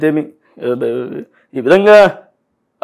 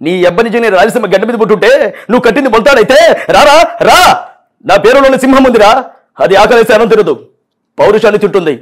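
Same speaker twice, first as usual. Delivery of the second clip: punch dialogue impression. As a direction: bellow the film hero's threats. Mimic performs Balakrishna-style film dialogues.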